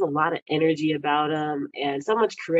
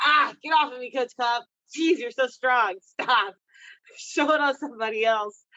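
higher, playful whine of being pinned; mock complaining to coach; 6 semitones higher than usual. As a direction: tease him as he shows a move on you.

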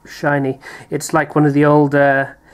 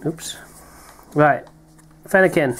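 normal talking voice

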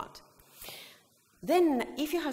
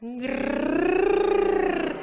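high loud grrr with a smile; affectionate purring grrr; smiling purr; 9 semitones higher, 7 dB louder than usual. Shown as a demonstration. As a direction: purr lovingly at your cat. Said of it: "And you smile".

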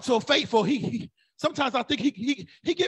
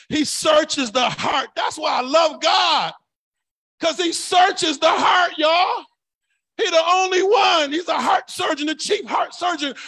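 shouted, rising sermon delivery; fiery preaching; 3 semitones higher, slower than usual. Real, not acted. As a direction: preach it hard to the church. Climax preaching.

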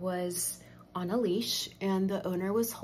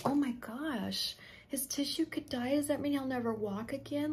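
higher-pitched anxious wondering; retold worry; 7 semitones higher than usual. Owner sitting there fearing for injured dog's leg, retold worry. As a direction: fret aloud, voice lifted.

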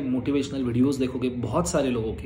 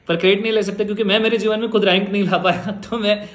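quick, louder, laughing self-mockery; laughing at himself; 5 semitones higher, 5 dB louder, faster than usual. Real, not acted. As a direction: laugh it off at your own expense. Admits he never got rank himself. (खुद पर हँसते हुए)

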